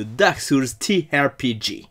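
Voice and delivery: French words in a clumsy, hammed-up English accent; a Frenchman mangling it in mock English accent; bad English accent. Shown as a demonstration. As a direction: put on a deliberately rotten English accent. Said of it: "mon accent anglais tout pourri".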